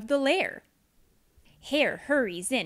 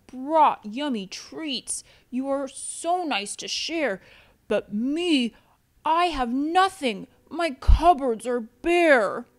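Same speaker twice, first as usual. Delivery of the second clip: rising, louder bear lament; a bear pouring out his woe; glum bear voice; 2 semitones higher, 5 dB louder than usual. Acted. Bear telling friends he has nothing to share.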